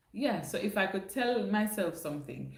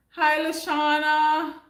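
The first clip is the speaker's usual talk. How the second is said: excited greeting; 8 semitones higher, 9 dB louder, slower than usual. Real, not acted. Greeting a viewer in chat.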